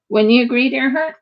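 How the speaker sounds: usual speaking voice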